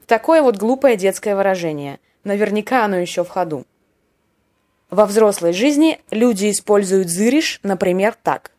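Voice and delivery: ordinary talk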